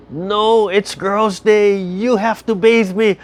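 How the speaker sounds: put-on high, slow and loud playful child; little girl voice. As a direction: play the giggly little granddaughter insisting. Granddaughter refusing, playful.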